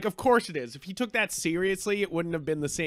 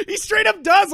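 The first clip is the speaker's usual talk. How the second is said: much higher, louder, laughing burst; gleeful high outburst; excited laugh-talk; 13 semitones higher, 8 dB louder than usual. Real, not acted. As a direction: blurt it out, delighted. Laughing at Thor killing Zeus.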